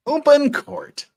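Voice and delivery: high, slow loud announcement; announcer. Acted. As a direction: call it out like a court clerk. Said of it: "announcing return to court".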